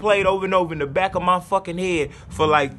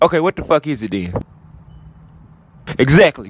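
deep and loud, egging on; low angry voice; 5 semitones lower, 5 dB louder than usual. Acted. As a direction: growl it as the dark side. Inner voice arguing.

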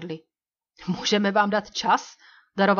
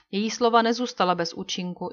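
ordinary delivery

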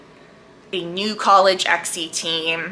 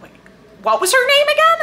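higher, louder squeal of disbelief asking the name again; excited disbelief; 5 dB louder, faster than usual. Retold or imitated. Reader meeting the author's grad students, higher and louder in excited disbelief. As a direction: jump up high as if you can't believe your ears. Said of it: "I was like".

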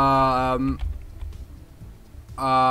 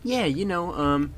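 put-on high-pitched girly character with a silly accent; stupid accent; 5 semitones higher than usual. Girl asking boyfriend for vegan food, silly high accent. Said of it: "doing that with a stupid accent".

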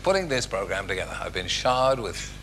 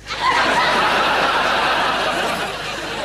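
high giggle; 7 dB louder than usual. Real, not acted. Narrator laughs.

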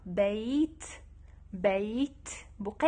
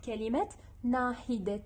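ordinary talk, nothing marked

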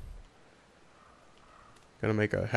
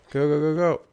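excited urging; 5 semitones higher, 6 dB louder than usual. Real, not acted. Urging characters on in game.

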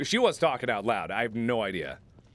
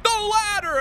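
high, loud squawk; hammed-up shrieked instruction; mock insistent viewers; 6 dB louder, slower than usual. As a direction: yell it like an impatient backseat gamer. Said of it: "You guys are like".

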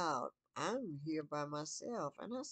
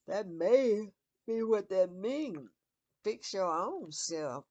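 emphatic; 6 semitones higher, 11 dB louder, slower than usual. Real, not acted. Emphatic sermon-like.

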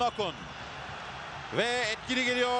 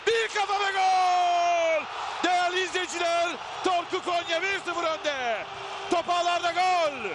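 screaming football commentator; 4 semitones higher than usual. Real, not acted. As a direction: erupt as the header goes in. Goal scored, commentator erupts.

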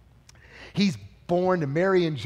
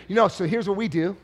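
usual speaking voice

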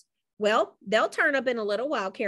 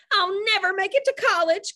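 weeping; 10 semitones higher, 5 dB louder than usual. A narrator's reading. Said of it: "Caroline wept".

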